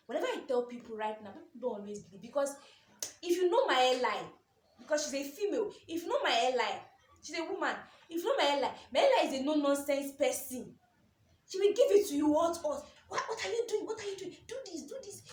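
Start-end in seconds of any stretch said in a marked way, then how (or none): 3.2-10.7 loud, high, animated storytelling; building it up, louder and higher; excited. Real, not acted. Telling the audience about her strict boss, loud and excited. 11.5-15.2 high, loud scolding imitation; mock strict boss. Imitating her no-nonsense boss scolding staff, high and sharp.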